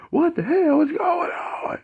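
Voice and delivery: very high, slow, stretched panic; bewildered. Retold or imitated. Mike out of his body, bewildered, very high and slow. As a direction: draw out the confusion up high.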